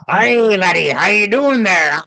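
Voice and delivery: put-on playfully butcher a Scottish accent to show it fails; mock Scottish accent. Tour host mocking a fake Scottish accent, hammed-up Scottish brogue. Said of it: "don't try to talk Scottish".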